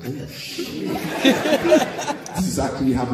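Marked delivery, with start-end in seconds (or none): 0.0-0.9 breathy unvoiced oh shit under a laugh; whispered thought. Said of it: "in my mind, I was like". 0.9-2.3 high giggle. Real, not acted. Realizing groupie moment.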